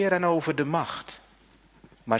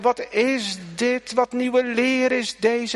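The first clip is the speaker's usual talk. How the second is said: amazed crowd; 10 semitones higher, 4 dB louder, slower than usual. Retold or imitated. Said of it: "En ze zeggen".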